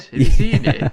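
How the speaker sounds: loud laughing question. Real, not acted. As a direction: laugh through the words. Laughing about the huge beard.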